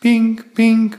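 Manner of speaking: beeping alarm; alarm ping. Acted. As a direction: chime it like an alarm clock. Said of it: "went my alarm".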